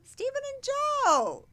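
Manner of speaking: high, slow fan squeal of the names; squealing fan cry; excited gush. Real, not acted. Fan gushing over band members.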